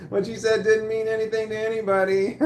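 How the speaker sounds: high, loud jeer with laughter; mocking taunt. Employee belittling shift manager before everyone, gloating taunt. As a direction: rub it in, amused and gloating. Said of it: "I started laughing and pointing".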